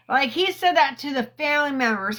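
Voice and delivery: normal talking voice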